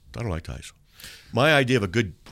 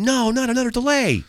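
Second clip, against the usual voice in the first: much higher whiny groan; impatient audience. Pretend. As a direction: whine like a bored audience. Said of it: "People are going".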